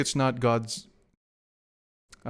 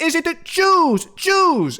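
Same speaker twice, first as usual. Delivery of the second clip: squeaky clip voice; 6 dB louder, slower than usual. Retold or imitated. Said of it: "Or it could be like".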